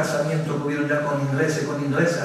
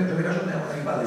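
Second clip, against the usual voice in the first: ordinary talk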